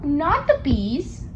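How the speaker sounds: plain reading voice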